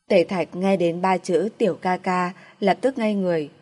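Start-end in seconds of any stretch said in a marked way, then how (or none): none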